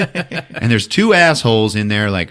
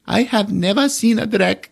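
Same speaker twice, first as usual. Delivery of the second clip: put-on kid voice; 14 semitones higher than usual. Voicing the boy.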